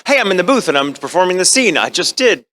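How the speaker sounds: a light touch of frantic Okabe confusion; wondering what timeline it is; confused character voice. Retold or imitated. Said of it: "my mind started kind of slipping into the character".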